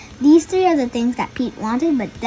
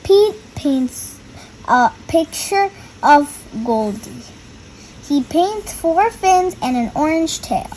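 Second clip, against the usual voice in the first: put-on reading to children; 4 semitones higher than usual. A narrator's reading. Reads the picture book aloud.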